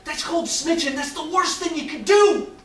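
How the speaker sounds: high, loud, frantic plea; pleading with his brother, high and loud; urgent warning. Retold or imitated. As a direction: warn him desperately not to snitch. Warning brother about snitching in prison.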